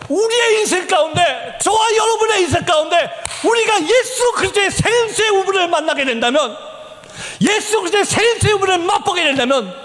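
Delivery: sermon climax, pitched high; impassioned preaching. Real, not acted. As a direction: proclaim it with rising fervor. Preaches at full force.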